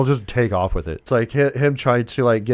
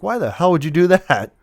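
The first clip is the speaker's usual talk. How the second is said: higher-pitched mock exasperation; exasperated protest; 7 semitones higher than usual. Son asking mom why she called dad, exasperated protest. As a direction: protest in disbelief, lightly acted.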